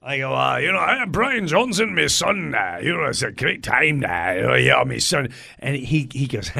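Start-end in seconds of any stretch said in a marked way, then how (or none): 0.6-5.3 swooping, singsong impression with filler words. Shown as a demonstration. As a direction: exaggerate the rock singer's rambling chat. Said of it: "I imitate him".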